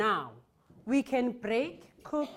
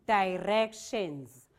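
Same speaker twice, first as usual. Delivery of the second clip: slow syllable model; 3 semitones lower, slower than usual. Shown as a demonstration. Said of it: "let's clap syllables for directions".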